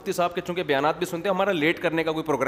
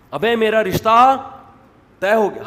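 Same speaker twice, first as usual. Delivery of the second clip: loud, slow, higher cry of joy; excited announcement; 6 semitones higher, 9 dB louder, slower than usual. Retold or imitated. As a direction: blurt out the good news with delight. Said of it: "کہہ رہے ہوتے ہیں خوشی سے".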